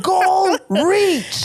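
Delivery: high, squeaky celebration; playful high victory call; mock triumph. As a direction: cheer it like a game prize.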